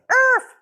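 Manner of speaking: high loud yap; sharp imitated yap; dog bark.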